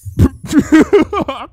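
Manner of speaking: loud high burst of laughter; sudden puff of laughter. A narrator's reading. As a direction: snort out a laugh you can't hold. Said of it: "Spike gave a sudden puff of laughter".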